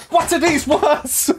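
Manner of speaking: reading through real laughter; giggling at a chat question; laughing read. Real, not acted. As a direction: laugh as you stumble on the words.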